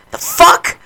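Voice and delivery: retold outburst, high incredulous snap; snapped. Griever retelling a blow-up at thoughtless people, snapped. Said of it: "I fucking snapped".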